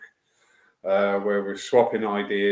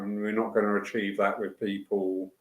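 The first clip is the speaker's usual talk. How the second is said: normal talking voice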